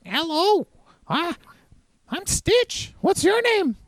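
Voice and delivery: very high, squeaky alien; chirpy little alien introducing itself; Stitch voice. Acted. Six-armed blue creature greets the party, squeaky and high. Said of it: "blue creature with six arms".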